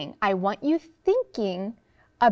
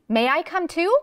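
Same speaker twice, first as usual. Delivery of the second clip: eager, higher-pitched storybook question; excited; 6 semitones higher than usual. A narrator's reading. Neighbor asks to join the bee chase, excitedly. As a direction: ask with excited hope, pitch climbing. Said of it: "Missus Govlock said excitedly".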